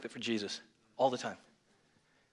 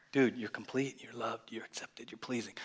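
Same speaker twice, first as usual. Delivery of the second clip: private pep talk; 7 dB quieter than usual. Retold or imitated. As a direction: murmur a private reminder to yourself. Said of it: "It was kinda like my little".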